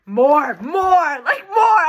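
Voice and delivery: loud, rising fangirl shouts; excited shouting. Real, not acted.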